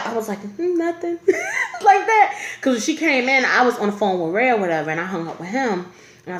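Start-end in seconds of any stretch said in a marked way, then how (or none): 0.4-1.2 small, high-pitched dodge; imitated timid kid answer; sheepish. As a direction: squeak out a guilty little denial. Retold sheepish answer to stern mother.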